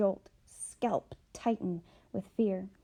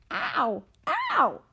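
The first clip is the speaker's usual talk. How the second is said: sharp high yelp, much louder on the second; pained cry; 5 semitones higher, 7 dB louder than usual. Acted. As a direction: cry out as if struck on the foot. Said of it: "she cried".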